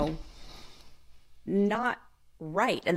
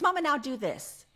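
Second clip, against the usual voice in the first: put-on toddler daughter; 7 semitones higher than usual. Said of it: "she says".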